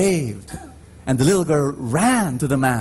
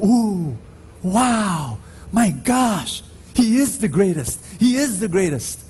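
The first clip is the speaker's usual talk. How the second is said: higher, awed cheers of an excited crowd; amazed crowd; 5 semitones higher than usual. Retold or imitated. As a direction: gush like spectators at a daredevil show. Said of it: "everyone was saying".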